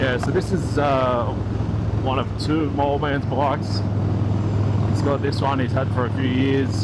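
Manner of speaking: muffled voice through a helmet with wind noise; speaking from inside a motorcycle helmet. Real, not acted. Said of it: "Hopefully you're not getting too much wind noise through the helmet".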